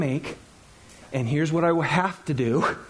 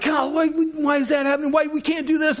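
higher, exasperated complaint to God; frustrated outburst; 9 semitones higher than usual. Retold or imitated. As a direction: vent the budget frustration. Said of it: "I'm like".

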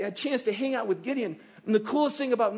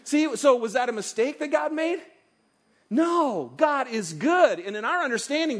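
usual speaking voice

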